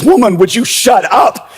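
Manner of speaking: high-pitched acted outburst; comic burst of irritation, pitch leaping up; exasperated husband. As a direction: play a fed-up husband snapping at his wife. Husband snaps at wife in joke.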